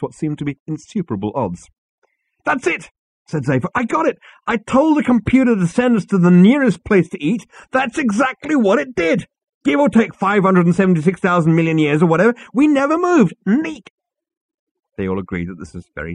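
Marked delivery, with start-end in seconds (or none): 2.4-13.9 very high, loud elated; triumphant. A narrator's reading. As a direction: crow about figuring it out. Zaphod triumphant realization.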